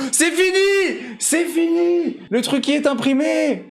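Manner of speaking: high-pitched triumphant yell; excited shout. Real, not acted. Streamer celebrating the finished print, excited shout.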